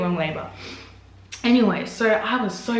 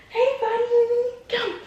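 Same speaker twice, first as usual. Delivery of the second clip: much higher, slow sing-song hello; sweet greeting; much slower than usual. Real, not acted. Mother to her waking son, slow high coo.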